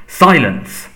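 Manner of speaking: angry master's order; sharp command. A narrator's reading. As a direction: shut the servant up at once. Master cuts off spluttering servant.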